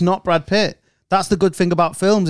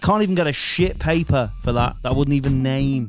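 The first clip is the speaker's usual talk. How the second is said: calm, steady advert voice; ad read; 2 semitones lower, 3 dB quieter than usual. Acted. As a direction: pitch the Patreon to listeners. Reads Patreon advert.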